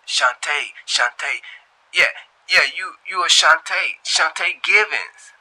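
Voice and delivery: high, loud eager voice; Darius remembering. A narrator's reading. Darius recalling Shantae's full name, high and loud. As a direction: slowly land on her full name. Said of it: "he said".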